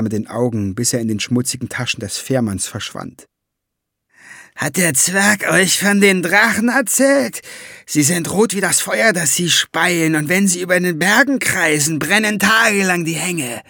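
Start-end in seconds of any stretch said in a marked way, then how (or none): 4.5-13.6 high ferryman voice spinning a dire tale; ominous ferryman. Acted. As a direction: warn them of the red dragons. Ferryman warns about dragons.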